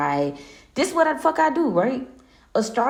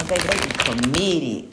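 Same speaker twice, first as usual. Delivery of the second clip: ordinary talk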